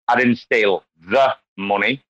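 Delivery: "the" set apart and weighted, slowly; slow line with an emphatic, separated "the"; stress on THE. Shown as a demonstration. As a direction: pause round a heavy "the". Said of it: "with five different, like, word stresses".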